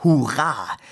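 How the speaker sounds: loud, high cry of joy; cheerful shout. A narrator's reading. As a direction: shout it out gleefully as she leaps. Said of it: "Sie aber rief".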